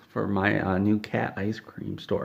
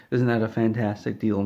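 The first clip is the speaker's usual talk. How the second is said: usual speaking voice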